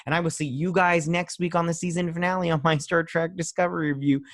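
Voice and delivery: high, fast, upbeat sign-off; youtuber outro. Acted. Host asking viewers to like and subscribe, upbeat outro. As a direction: pitch it up and rattle off the channel plugs.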